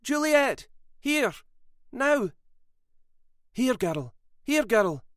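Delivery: high, urgent calls to a dog; two women calling the dog in a hurry. A narrator's reading. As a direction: call the dog back, urgently. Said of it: "both calling to her".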